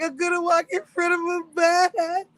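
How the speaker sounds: high laughing quote; laughing while quoting. Real, not acted. Quoting his bus threat, laughing through it.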